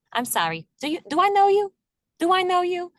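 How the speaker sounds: high, loud mock New York accent; thick New York accent. Woman on the street, thick New York accent, loud and high. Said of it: "with a thick accent".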